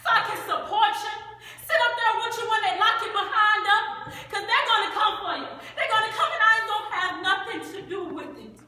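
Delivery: high, loud scolding; indignant refusal. Acted. Crystal turning down Bo's marriage proposal, indignant. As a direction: throw it back at the suitor, heated. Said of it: "Crystal said".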